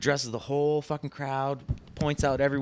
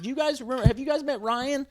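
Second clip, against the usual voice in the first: higher imitating voice; mock producer; 12 semitones higher than usual. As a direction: ask the crowd brightly like a host. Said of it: "He's like".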